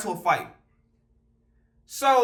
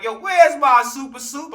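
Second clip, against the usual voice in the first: a louder, higher, slow customer asking for his soup; demanding customer; 5 semitones higher, 6 dB louder, slower than usual. Retold or imitated. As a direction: play the impatient diner calling out.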